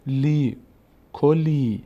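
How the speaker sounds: کلی with a held "lee"; long vowel. Shown as a demonstration. Teacher showing lam before ya, long vowel. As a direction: stretch the ee at the end of کلی. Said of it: "صدای ای".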